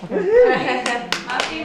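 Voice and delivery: loud, high call across the room with a laugh; host calls Muffy up to the mic, loud and laughing. Real, not acted. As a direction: call her name out to the crowd, laughing. Host calling speaker up.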